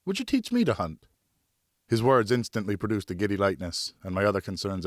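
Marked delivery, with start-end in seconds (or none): 0.0-1.0 put-on much higher, eager young son; boy's voice. Son asks his father for a moment, in a high boyish voice. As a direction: voice the boy hopeful and polite.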